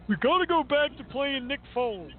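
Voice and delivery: mocking radio schmucks. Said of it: "heard so many schmucks on radio today say".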